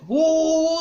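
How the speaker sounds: loud high whoop; hammed-up whoop; mock yelling whoop. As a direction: imitate a yell. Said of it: "we'll start yelling".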